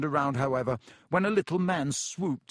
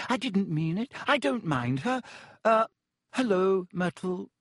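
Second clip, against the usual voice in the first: flustered; 7 semitones higher than usual. Acted. Panicked girl covering herself.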